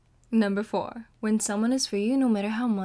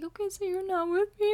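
much higher, hurt little question; teary question; 11 semitones higher than usual. Retold or imitated. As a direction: ask it close to tears. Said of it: "I'm like".

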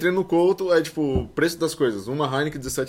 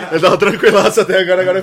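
laughing; 4 semitones higher, 7 dB louder than usual. Real, not acted. Laughing after own joke.

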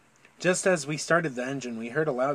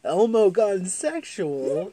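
clickbait voice; 5 semitones higher, 4 dB louder, much slower than usual. Acted. Clickbait title voice.

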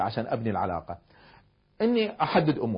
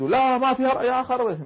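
stubborn denial; 11 semitones higher, 6 dB louder than usual. Retold or imitated. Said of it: "لو بدأ يعاند".

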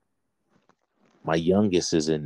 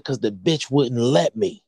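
louder, higher, drawn-out retort; retold angry comeback; 5 semitones higher, 5 dB louder, slower than usual. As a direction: fire back slowly, raised and emphatic.

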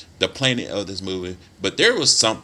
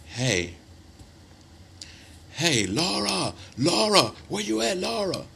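higher, slow calling out for Laura; mock frantic husband yelling for his wife; imitating a husband calling out; 10 semitones higher than usual. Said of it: "he's screaming at the top of his".

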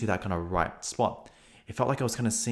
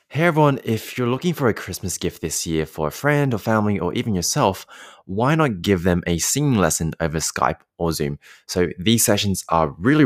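upbeat promo voice, brighter and louder; ad read; 3 semitones lower, 5 dB louder than usual. Acted. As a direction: sell it like a friendly radio spot. Ad read for lessons.

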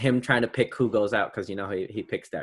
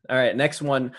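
usual speaking voice

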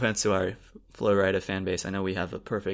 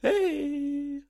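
squeaky falsetto hey; high squeaky voice. Shown as a demonstration. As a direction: pop way up into a squeak. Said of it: "What do you think of this voice?".

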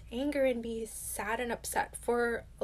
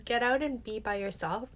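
normal talking voice

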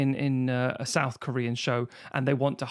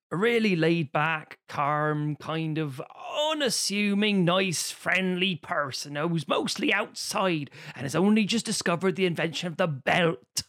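slow, laid-back West Country voice; drawn-out friendly West Country countryman; West Country accent; 2 semitones higher, slower than usual. Shown as a demonstration. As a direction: play an unassuming West Country outdoorsman, slowly. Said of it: "hobbits have West Country accents".

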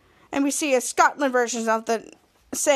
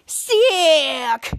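long, drawn-out mock Scottish "Sick"; exaggerated Scottish cry of "Sick"; Scottish accent; 4 dB louder than usual. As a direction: stretch "Sick" out in imitated Scottish. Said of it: "Scottish Mary Gabrielman said".

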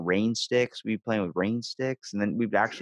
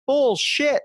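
high, louder cry of disbelief; indignant protest; 3 dB louder than usual. Retold or imitated. Student protesting to chorus teacher, a high indignant yelp. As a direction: yelp it like the charge is absurd.

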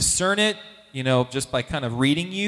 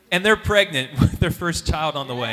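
ordinary talk

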